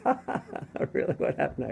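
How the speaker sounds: high, laughing mock girl voice. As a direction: imitate her amused disbelief.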